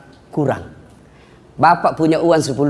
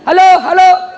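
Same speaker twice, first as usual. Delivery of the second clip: high, loud mock hello; phone shouting; 13 semitones higher, 7 dB louder than usual. Villager shouting into upside-down handset, mock phone yell. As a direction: call out twice into a dead receiver.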